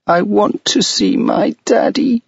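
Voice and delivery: put-on a high child's voice breaking into tears; a girl crying for her father; crying little girl. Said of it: "she cried".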